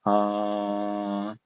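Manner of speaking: ah held long and level; flat tone. Shown as a demonstration. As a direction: hold ah on one steady pitch. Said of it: "the flat tone".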